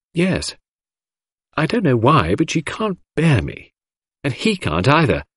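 a hint of a coaxing young girl; young girl Cynthia. A narrator's reading.

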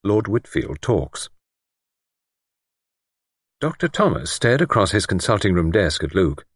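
ordinary delivery

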